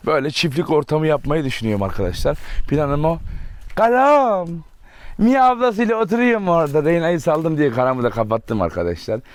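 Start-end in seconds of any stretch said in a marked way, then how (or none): 3.7-4.5 high, loud call of a pet's name; calling the dog. Real, not acted. Owner calling his dog Karam, high and loud. 5.2-6.8 high cooing question; pet talk. Real, not acted. Owner cooing to his dog, high-pitched baby talk.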